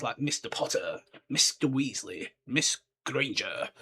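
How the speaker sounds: ordinary delivery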